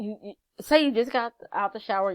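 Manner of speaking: ordinary talk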